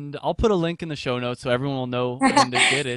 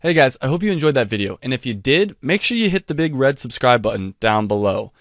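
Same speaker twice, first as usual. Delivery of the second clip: louder, upbeat sign-off to viewers; outro pitch; 7 dB louder than usual. Acted. Host signing off to his viewers, upbeat outro read. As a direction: sell the subscribe button. Host's outro call to action.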